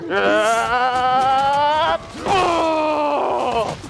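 long rising yell, then falling howl; drawn-out "aaah, ohhh"; battle cry. Pretend. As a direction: wind up and release an attack. Power attack cry.

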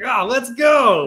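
slow, high put-on voice; eager mock voice. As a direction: stretch it out in a high silly voice.

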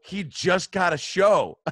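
loud, high, drawn-out complaint; acted slow-burning outrage; livid disbelief. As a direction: stretch it out in indignant protest. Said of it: "I was livid".

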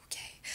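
breathy sigh into a soft okay; relieved sigh. Shown as a demonstration. Showing viewers how the body lets go, a relieved sigh. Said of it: "yawns and sighs are great signs".

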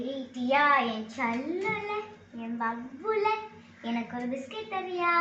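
soft, slow, wheedling pet names; butter her up softly to get a biscuit; coaxing sweet talk. Acted. Older sister coaxing Diya for a biscuit, soft and sweet.

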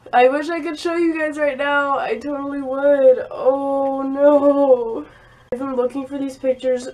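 loud, high wail of dismay; dismayed outburst. Real, not acted. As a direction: cry it out loudly, dragging out the end. Exclaims over pictures she cannot show.

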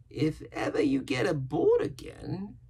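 high, slow sailor voice; sly, startled castaway. A narrator's reading. Castaway repeating the boy's words, slyly. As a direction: echo it back high and slow, warily.

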